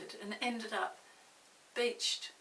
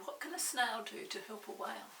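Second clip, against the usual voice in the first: ordinary delivery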